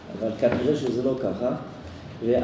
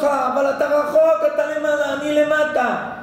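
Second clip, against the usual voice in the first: put-on high, loud child calling up from far below; much higher, louder child's voice calling out; 12 semitones higher, 4 dB louder than usual. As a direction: call up to dad from the bottom. Child answers father from below.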